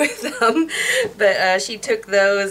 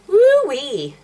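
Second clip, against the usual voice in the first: excited; 8 semitones higher, 6 dB louder than usual. Real, not acted. Reacting to hot fuchsia shade.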